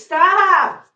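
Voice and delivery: loud, high command to the cats; 1 sharp stop. Real, not acted. Streamer ordering her cats off the pile, loud. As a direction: bark it at the cats.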